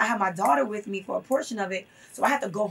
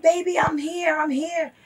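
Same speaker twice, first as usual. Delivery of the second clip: imitated anxious mother, raised high and louder; retold motherly calling; 7 semitones higher, 5 dB louder, slower than usual. Mother to her son in the prison window, retold urgent calling. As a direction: act out calling reassurance up to your child.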